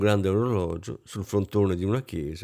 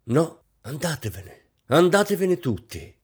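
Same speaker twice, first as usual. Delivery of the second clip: snap, rising to a high-pitched dismissal; snapping; 6 semitones higher than usual. A narrator's reading. Hostess dismissing the musicians, snapping. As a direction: burst out, on the edge of hysteria. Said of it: "scattò Rosine".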